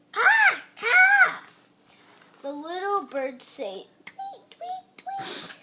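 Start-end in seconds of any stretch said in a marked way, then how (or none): 0.1-1.3 very high shouted caws; shrieked crow caws. Acted. Child imitating a crow. 4.0-5.5 very high, soft tweets; tiny high birdsong tweets; bird tweets. Acted. Child imitating little birds.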